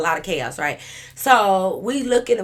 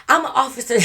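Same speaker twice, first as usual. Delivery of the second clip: higher, louder line breaking into laughter; laughing mock claim; 7 semitones higher, 6 dB louder than usual. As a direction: laugh through the drunk officer claim. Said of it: "So Peanut is like".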